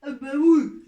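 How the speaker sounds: usual speaking voice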